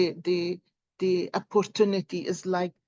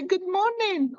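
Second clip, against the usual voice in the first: cheery greeting; 11 semitones higher than usual. Retold or imitated. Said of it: "I say".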